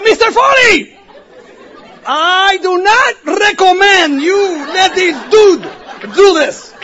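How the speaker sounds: high loud slow shouted warning; shouting. Retold or imitated. Eddie yelling to Foley from the back seat, slow loud panicked warning. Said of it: "Eddie sale gritando".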